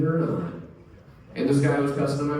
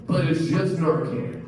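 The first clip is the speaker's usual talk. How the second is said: usual speaking voice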